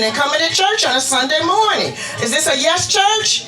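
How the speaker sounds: rousing call to the congregation; rallying shout. Real, not acted. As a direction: fire up the church.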